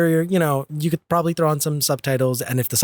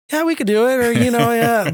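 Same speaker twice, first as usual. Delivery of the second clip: breezy agreeable; 9 semitones higher, 5 dB louder than usual. Retold or imitated. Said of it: "I'm like".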